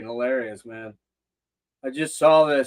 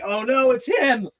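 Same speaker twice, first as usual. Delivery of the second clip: higher, slow, lightly voiced groan of dismay; a hint of a friend dreading the encounter; mock dismay; 9 semitones higher, slower than usual. Kevin spotting the host coming over, mock higher dismay. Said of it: "I'm sure Kevin would be like".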